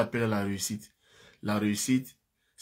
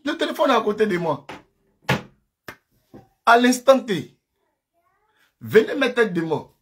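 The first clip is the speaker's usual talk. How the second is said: much higher and louder, jeering at rivals with laughs; fired-up taunting; 6 semitones higher, 7 dB louder than usual. Real, not acted. Host hyping up the live, taunting rival streamers, loud high jeering. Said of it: "Quand je parle comme ça".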